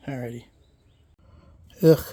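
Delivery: usual speaking voice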